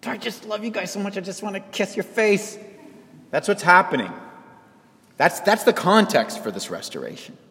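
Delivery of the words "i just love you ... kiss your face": doting affection. Retold or imitated.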